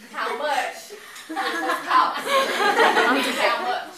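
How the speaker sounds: loud, slow, laughing delivery; loud hammy translation. Acted. Vice president translating Spanish for the meeting, loud and slow. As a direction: spell it out loudly for slow learners. Acted lesson, translation shouted slowly.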